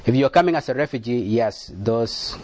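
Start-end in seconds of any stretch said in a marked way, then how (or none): none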